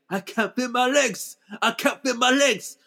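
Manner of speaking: high, hammed-up cry of panic; mock anguished cry. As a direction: wail it like a melodramatic hero. Xavier shot, retold cry.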